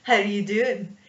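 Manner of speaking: cocky chat-up in thick mock-Scottish; swaggering Scottish dwarf; confident Scottish dwarf. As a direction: proposition boldly in a bad Scottish accent. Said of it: "he'd be all confidence".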